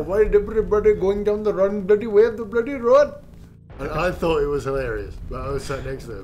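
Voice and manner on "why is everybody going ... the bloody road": put-on loud, high Indian accent complaint; mock-outraged Indian accent on comms. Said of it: "like, an Indian accent".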